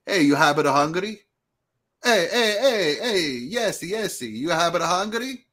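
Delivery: put-on playful grill cook calling out, thick Italian accent; broken-English Italian accent. Grill cook Mimo asking if he's hungry, Italian accent. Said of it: "did not speak English".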